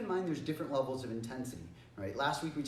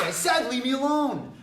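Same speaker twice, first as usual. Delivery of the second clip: high, very loud demand; in-your-face shout; 11 semitones higher, 11 dB louder than usual. Shown as a demonstration. Teacher shows jumping in a bully's face, shouting. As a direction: yell it as a forceful final order. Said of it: "jump in their face and say".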